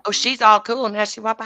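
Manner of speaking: ordinary talk, nothing marked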